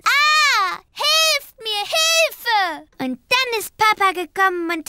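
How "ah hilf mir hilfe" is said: childlike retold yell for help; calling for help. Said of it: "sie hat gerufen".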